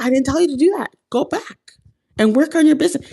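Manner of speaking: high, firm, scolding dad voice; fatherly scolding. Retold or imitated. God speaking to her like a father, lightly scolding. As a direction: set her straight like a stern parent. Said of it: "He sounded like a father".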